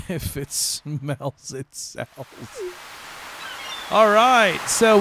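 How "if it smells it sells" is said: slow, soft chuckling echo; amused under-breath repeat; condescending chuckle. Real, not acted. Chuckling echo of NPC line.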